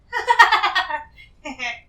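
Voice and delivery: loud laugh. Acted. Hoarder laughing loudly while denying she owns the object. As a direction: burst of loud amused laughter. Laughs off her own ridiculous denial.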